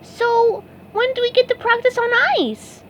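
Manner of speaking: high eager sponge; SpongeBob voice. A narrator's reading. SpongeBob asking Patrick about ice, high eager voice. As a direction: innocent question.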